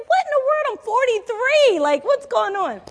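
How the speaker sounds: high, loud incredulous outburst; acting out baffled indignation; shocked disbelief. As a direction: exclaim it loudly in mock outrage, way up high. Acts out own shocked reaction to therapist.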